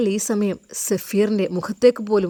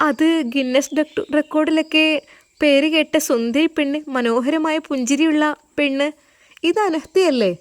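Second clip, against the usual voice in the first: higher, eager gushing praise; excited gushing; 7 semitones higher than usual. Acted. Lawyer excitedly describing a famous beauty. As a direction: gush about the famous beauty.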